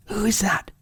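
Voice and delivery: put-on a startled young boy calling into the dark; high, wary Peter waking up; boy's wary call. Peter wakes to knocking.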